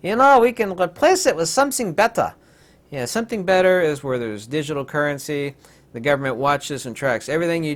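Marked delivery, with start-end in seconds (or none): 0.0-2.3 mock scheming villains. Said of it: "It's like".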